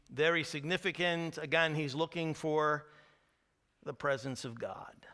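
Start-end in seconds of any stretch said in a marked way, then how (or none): 3.8-5.1 whisper. Real, not acted. Reverent aside about God.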